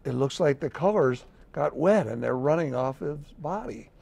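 usual speaking voice

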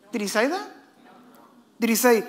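ordinary talk

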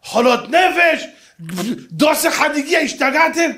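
high, loud furious scolding; yelling at them. Acted. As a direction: scream insults at the women, loud and high. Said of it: "והתחילה לצעוק עליהם".

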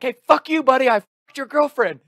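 high, loud cocky yell; mock yell. Taunting the girl's boyfriend, high loud yell. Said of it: "I'm like".

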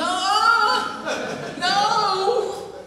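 very high, loud delighted no; excited refusal. Acted. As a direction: refuse to give up the taste. Refusing to swallow the food.

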